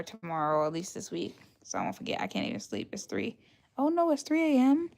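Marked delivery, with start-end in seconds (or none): 1.6-3.4 hushed whisper to the chat; whispering so nobody wakes up. Real, not acted. Late night, hushed realization. 3.8-4.9 higher, playful gasp at the time; a touch of dramatic surprise; mock shock. Real, not acted. Shocked at the late hour.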